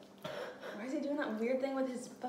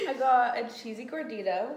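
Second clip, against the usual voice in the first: laughing; 4 semitones higher, 7 dB louder than usual. Real, not acted. Laughing while eating on camera.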